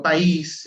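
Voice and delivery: país with the stress on Í: pa-ÍS; Portuguese país, weight on Í; accented í. Shown as a demonstration. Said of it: "con tilde en la I".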